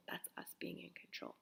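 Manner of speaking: whispered punchline, fading away; emphatic whisper. Real, not acted. Speaker warning parents about control, in a hushed whisper. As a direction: drop to a pointed whisper.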